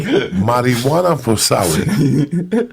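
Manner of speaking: a dealer murmuring to passersby in Cuban English; imitating a Cuban dealer's broken English; Cuban street hustler. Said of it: "he would look at them and go".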